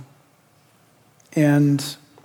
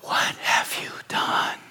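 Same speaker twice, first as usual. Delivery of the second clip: seductive tempter voice; slower than usual. Retold or imitated. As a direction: whisper like the devil, then accuse. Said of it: "in that seductive voice".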